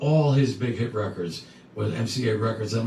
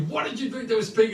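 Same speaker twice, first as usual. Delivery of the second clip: retold yelling; 9 semitones higher, faster than usual. Father questioning his drunk son, mock yelling. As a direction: imitate an irate father's rapid outburst. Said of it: "he's yelling at me".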